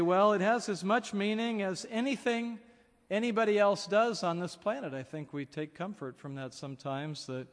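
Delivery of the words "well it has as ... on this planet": lightly voiced objection, higher and louder; imagined objector. Listener defending life's meaning to the preacher, a raised objection. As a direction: push back as an imagined listener, pitched up. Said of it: "You might say".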